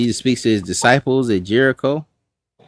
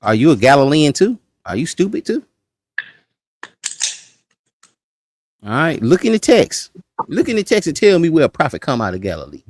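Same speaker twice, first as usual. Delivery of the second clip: incredulous, higher-pitched taunt; mocking challenge; 5 semitones higher than usual. Pharisees to a colleague defending a Galilean, mocking. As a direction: challenge him with rising disbelief. Acts out rulers mocking Nicodemus.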